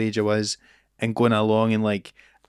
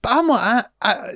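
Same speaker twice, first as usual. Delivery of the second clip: ordinary talk, nothing marked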